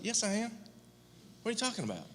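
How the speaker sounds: high, startled protest; replaying his own shocked reply, pitched high; taken aback. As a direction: squeak out the denial in disbelief. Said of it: "I was like".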